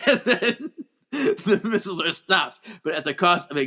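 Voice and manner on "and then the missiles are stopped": ordinary talk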